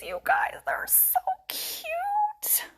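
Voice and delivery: very high, slow cooing gush; squealing delight. Real, not acted. Showing viewers daisy pants in a slow high squeal. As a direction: melt over them in a high squeal.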